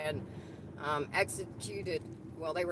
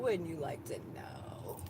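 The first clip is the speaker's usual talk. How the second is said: sultry teasing; 2 semitones lower, 6 dB quieter, slower than usual. Acted. Teasing question repeated twice.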